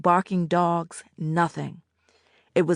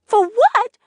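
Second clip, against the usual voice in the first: "For what" much higher and loud, pitched up in disbelief; incredulous girl; 10 dB louder than usual. A narrator's reading. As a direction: fling back "For what" in outrage. Annette's disbelief.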